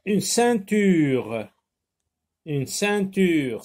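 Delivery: slow, drawn-out ceinture; teacher modelling a word for young learners; slow model word. Shown as a demonstration. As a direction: stretch out ceinture so they hear the nasal. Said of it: "Dans le mot ceinture".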